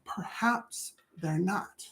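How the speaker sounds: slow hushed whisper of Perhaps they're not; whispered, slow, soft. Shown as a demonstration. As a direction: breathe it like a secret, drawn out. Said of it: "repeat as whisper".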